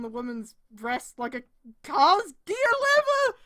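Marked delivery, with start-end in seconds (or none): 1.8-3.4 high, loud disbelieving yell; incredulous shout. Real, not acted. Streamer reading fanfic aloud, yelling in disbelief. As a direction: shout the line out in outrage.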